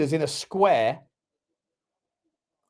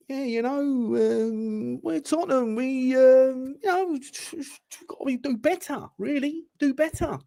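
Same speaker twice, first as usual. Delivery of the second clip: imitating a mild ex-manager pundit; Glenn Hoddle; 8 semitones higher, slower than usual. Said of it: "Glenn Hoddle talks a little bit like this".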